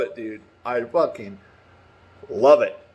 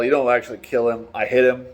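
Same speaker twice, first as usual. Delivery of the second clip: normal talking voice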